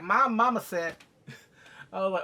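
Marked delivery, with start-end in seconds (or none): 0.0-1.0 put-on loud, higher mama's-boy voice; goofy, forceful mock insistence; Bobby Boucher. Mocking the son as a mama's boy, goofy and loud. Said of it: "Okay, Bobby Boucher".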